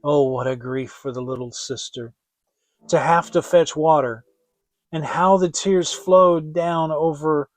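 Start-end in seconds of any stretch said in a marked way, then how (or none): none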